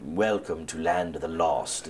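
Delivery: slow, hammed-up British supervillain; deliberately terrible British accent, like an evil villain; bad British accent. Shown as a demonstration. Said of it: "I sound like a weird, like, supervillain".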